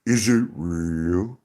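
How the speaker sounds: slow, stretched-out question; drawn-out question. Acted. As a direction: draw the words right out. Rhetorical question posed theatrically.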